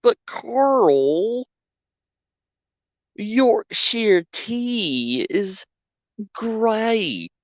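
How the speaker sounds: high, slow put-on British pitch for tea; mock British ad voice. Mock ad voice about Yorkshire tea.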